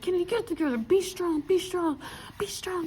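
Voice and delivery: self pep talk. Retold or imitated. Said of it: "I was like".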